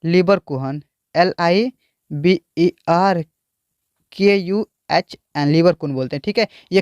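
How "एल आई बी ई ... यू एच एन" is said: slow, letter by letter spelling; spelled out letter by letter. Shown as a demonstration. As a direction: spell LIEBERKUHN slowly. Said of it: "देखिए इसको क्या बोलेंगे हम?".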